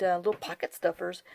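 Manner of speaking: usual speaking voice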